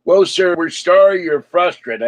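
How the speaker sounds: mocking the customer service woman's canned apology; mocking customer service rep. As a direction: parrot the rep's scripted apology. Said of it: "the woman starts telling the supervisor".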